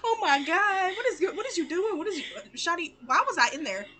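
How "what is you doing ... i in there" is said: usual speaking voice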